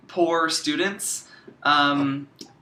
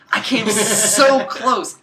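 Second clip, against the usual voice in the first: laughing; 6 semitones higher, 6 dB louder, much slower than usual. Real, not acted. Laughing regret about marijuana plant.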